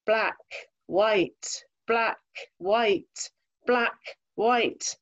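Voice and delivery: imitated echo, each word set apart; cave echo. A narrator's reading. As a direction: lightly mimic words echoing round a cave, pausing between them. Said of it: "you hear the echo in the cave".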